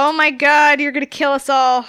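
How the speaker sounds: loud, exasperated outburst; loud protest. Acted. Player protests risky in-game choice.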